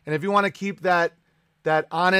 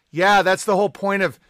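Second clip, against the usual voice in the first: normal talking voice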